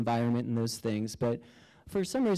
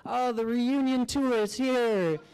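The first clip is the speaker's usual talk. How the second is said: put-on much higher, bright and slowed, like welcoming old friends; high giggly spirit voices; 10 semitones higher, slower than usual. Guardian spirits welcoming the narrator back, in much higher party voices. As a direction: sing out the welcome as joyful spirits. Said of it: "They're like".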